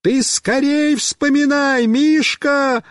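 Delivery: high, drawn-out urgent call; shouting. A narrator's reading. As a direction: holler to a friend to hurry. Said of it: "опять кричу я".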